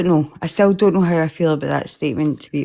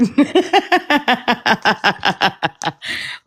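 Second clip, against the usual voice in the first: real laugh trailing down; long falling laugh; 6 semitones higher than usual. Real, not acted. Listener laughing at the quoted line, long falling laugh. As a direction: let a laugh roll out and fade.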